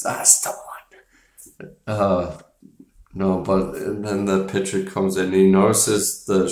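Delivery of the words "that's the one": slow, hushed whisper; slow whispered aside. Retold or imitated. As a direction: breathe it out slowly. Retelling Brody's line.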